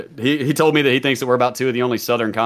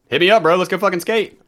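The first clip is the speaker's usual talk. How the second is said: high, louder burst of enthusiasm; rallying call-out; 8 semitones higher, 4 dB louder than usual. Real, not acted. Calling out to an old skating friend, rallying. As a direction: call out to a friend to come along.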